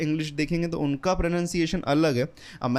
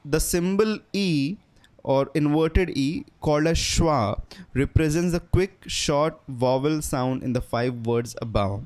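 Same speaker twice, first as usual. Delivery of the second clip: very slow, careful textbook read; deliberate slow reading for learners; slow reading aloud; much slower than usual. Shown as a demonstration. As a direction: read it out word by word. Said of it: "एक sentence दिया".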